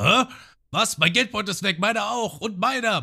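hoch, laut, hysterisch aufgeregt; empörte Dorfbewohner. Retold or imitated. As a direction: spiel panische Dorfleute nach. Dorfbewohner vermissen Geldbeutel. (hohe, aufgeregte Stimme der bestohlenen Dorfbewohner)

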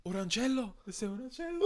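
hammed-up saleswoman; chirpy saleswoman. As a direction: sing it like a keen shop lady. Saleswoman's answer retold.

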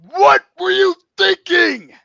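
high, slow, loud demand; indignant shout. Acted. Wrestler confronting Vince over the match, indignant shout. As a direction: holler it out, stretching each word.

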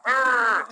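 sudden high, loud "Argh!" of exasperation; frustrated growl. Retold or imitated. Stuck in a queue after someone cuts in, frustrated growl. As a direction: let out a burst of pent-up frustration. Frustrated at line-cutter in supermarket.

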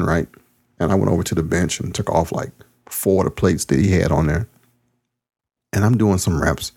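ordinary delivery, nothing marked

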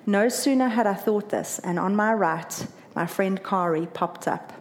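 ordinary delivery, nothing marked

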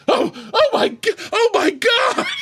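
very high, loud starstruck squeal; shocked fanboy freak-out. Acted. Fan finding the bar owner right beside him, hammed-up shocked squeal.

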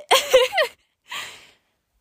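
high, laughing protest. Real, not acted. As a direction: laugh it off, then insist. Laughs at own joke.

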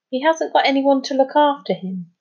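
mildly indignant protest; objecting. A narrator's reading. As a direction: stick up for someone. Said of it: "objected Jazz".

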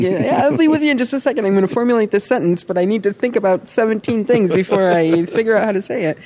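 a busy brain-operator rushing to answer; frantic inner voice. Retold or imitated. Woman behind the curtain in her head, talking fast and high. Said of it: "pay no attention to the, to the woman behind the curtain".

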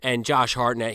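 ordinary talk

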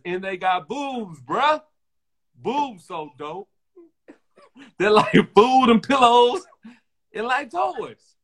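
put-on high, slow, gushing kid voice, laughing; hammed-up little boy gushing; imitating a kid. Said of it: "He says".

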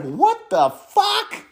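high, drawn-out, disbelieving what the fuck; stunned disbelief. Retold or imitated. Watching the briefing, high and slow in disbelief. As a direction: let it stretch up in shock. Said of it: "And I was like".

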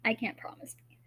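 hushed joking aside; whispering. Real, not acted. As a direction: whisper it with a grin.

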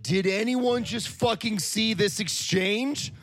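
stunned disbelief. Retold or imitated. After the stranger walks off, to anyone nearby, incredulous and loud. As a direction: ask the room in shock.

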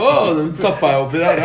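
playing the friend who laughs as he confesses the prank; laughing while quoting. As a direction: quote it through a loud laugh. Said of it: "du står der og ler".

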